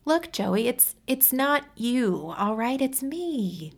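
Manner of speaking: high, slow, halting plea; pleading woman voice. Acted. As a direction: soften the blow, hesitating.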